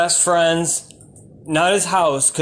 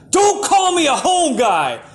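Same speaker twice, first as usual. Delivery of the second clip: 1 indignant shout; 8 semitones higher than usual. Retold or imitated. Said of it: "And I was like".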